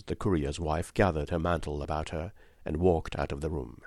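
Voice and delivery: plain reading voice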